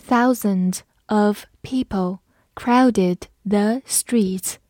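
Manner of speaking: Thousands of people crowded the streets said slowly; slow read-along. Shown as a demonstration. Teacher reading slowly so students can follow. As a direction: read slowly, each word clear. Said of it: "我们慢读一遍".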